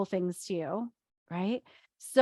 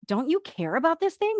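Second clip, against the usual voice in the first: worked-up accusation; 8 semitones higher than usual. Retold or imitated. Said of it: "have you ever had someone say to you".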